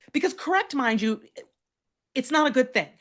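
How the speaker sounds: ordinary delivery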